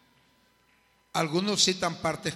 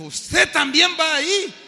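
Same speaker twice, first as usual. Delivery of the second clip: high, loud disbelief; incredulous young man; 10 semitones higher, 10 dB louder than usual. Retold or imitated. Young man can't believe the preacher goes there, loud and high. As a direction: sound shocked and scornful. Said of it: "Entonces me dijo".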